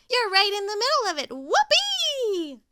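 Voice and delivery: cheering Pig. Acted. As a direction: whoop it out in glee.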